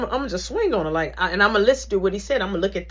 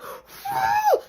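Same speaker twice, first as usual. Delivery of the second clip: high gasping whew. Retold or imitated. Gasp after being winded.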